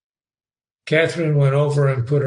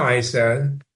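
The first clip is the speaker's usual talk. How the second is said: plain reading voice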